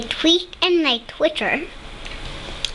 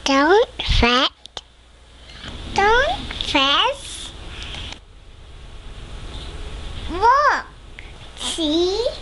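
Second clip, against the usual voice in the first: high soothing mouse voice; squeaky mouse; 9 semitones higher, slower than usual. Acted. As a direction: squeak gently to comfort him. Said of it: "Mouse squeaks".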